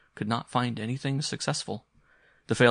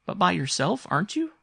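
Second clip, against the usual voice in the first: put-on high, loud child's questioning; insistent boy; 10 semitones higher, 5 dB louder than usual. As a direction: press her, rising high at the end. Boy presses his mother.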